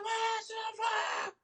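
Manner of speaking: very high strained mock scream; screaming in pain. Child screaming to his dad after dream, mock agony. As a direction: shriek it high without full volume. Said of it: "I'm just, like, screaming in pain".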